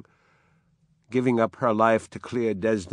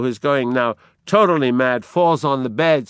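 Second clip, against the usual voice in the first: usual speaking voice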